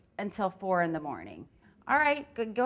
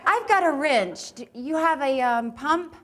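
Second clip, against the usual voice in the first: excited; 5 semitones higher, 4 dB louder than usual. Acted. Acting improv scene with partner.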